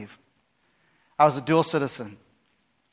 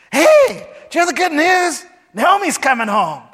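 much higher, louder excited calling; excited townsfolk; 11 semitones higher, 6 dB louder than usual. Retold or imitated. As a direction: gush the good news like a gossiping neighbour. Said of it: "They're all excited".

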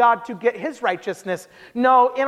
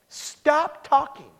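slow, higher-pitched, each word set apart; mock exasperation, stretched out; exasperated plea; 5 semitones higher, much slower than usual. Paul fed up with the self-righteous, exasperated.

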